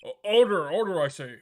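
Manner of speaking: loud stammered call to order; loud judge demanding order. Acted. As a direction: bang the gavel with your voice. Judge calls courtroom to order.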